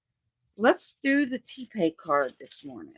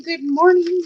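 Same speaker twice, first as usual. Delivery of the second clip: singsong greeting; 8 semitones higher, 5 dB louder than usual. Real, not acted. Greeting live viewers.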